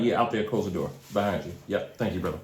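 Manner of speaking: ordinary talk, nothing marked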